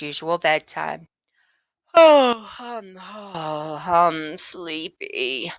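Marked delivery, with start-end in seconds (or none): 1.9-5.5 slow, yawning fake-sleepy voice; mock sleepy. Boy faking sleepiness to his mother, slow and drowsy. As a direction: drag it out like a boy pretending to be tired. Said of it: "John announced".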